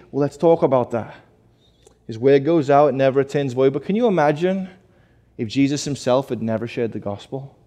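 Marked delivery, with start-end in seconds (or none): none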